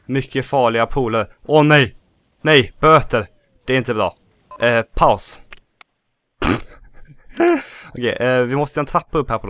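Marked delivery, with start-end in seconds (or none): 1.5-3.3 alarmed outburst. Real, not acted. Park gets fined. 7.3-7.8 high short laugh. Real, not acted. After pausing the game.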